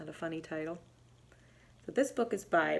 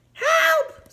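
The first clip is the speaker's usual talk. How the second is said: very high, loud squeal; squeal for help; 8 dB louder than usual. Acted. Mouse squealing for help in Bobcat's claws, very high. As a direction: squeal in fright. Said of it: "squealed Mouse".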